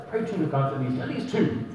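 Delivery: soft, low, half-whispered nudge to pray; a hushed mock parent urging prayer; quiet parent's reminder. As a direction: murmur it like a parent coaxing a sleepy child. Parents should tell the child.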